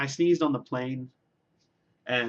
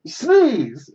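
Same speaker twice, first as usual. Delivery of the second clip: much louder and higher, yelled like a sneeze; shouted sneeze word; 9 semitones higher, 11 dB louder than usual. Shown as a demonstration. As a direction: blurt it out mid-sneeze. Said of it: "this funny thing that I do where I go".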